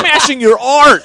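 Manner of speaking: laughing high loud yell; hammed-up improv yell through laughter; mock shout. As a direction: shout it while cracking up. Improvisers acting out scene.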